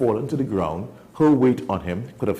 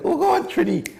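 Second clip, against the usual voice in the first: imitating animal's exclaim. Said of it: "he was like".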